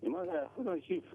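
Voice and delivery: faint, softly spoken greeting; too quiet to hear. Real, not acted. Caller greets the programme's hosts, too quiet to hear. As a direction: greet softly, barely audible down the line. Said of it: "Speak up, please. We can't hear you".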